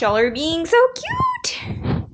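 slow sing-song cooing. Real, not acted. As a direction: coo over them fondly. Cooing at kids/pets.

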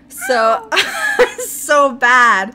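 high, loud, helpless laughing wail; crying with laughter. Real, not acted. Podcaster unable to get straight, crying with laughter. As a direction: fight to speak through a laughing fit. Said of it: "you're making me cry".

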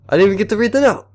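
high, loud complaint; frustrated. Real, not acted. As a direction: whine about the note. Frustrated at game.

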